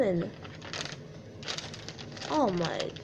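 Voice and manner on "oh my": ordinary delivery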